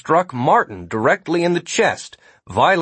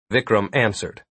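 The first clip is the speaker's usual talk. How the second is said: plain reading voice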